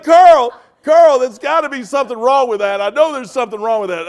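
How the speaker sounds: loud, high retold shout at a friend; retold yell. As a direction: belt out his name like a warning across the pier. Yelling at Carl on pier.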